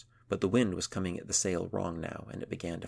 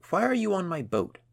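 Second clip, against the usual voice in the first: high, loud demand; 12 semitones higher, 7 dB louder than usual. A narrator's reading. Girl demanding the seal explain itself, indignant and loud. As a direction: confront the seal sharply. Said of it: "she demanded".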